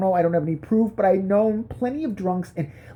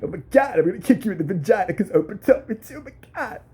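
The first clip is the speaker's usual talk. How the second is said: lightly put-on voice, fast; Travolta impression; 4 semitones higher, faster than usual. Comic bodyguard voice with a light put-on threat. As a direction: toss off a goofy Travolta threat.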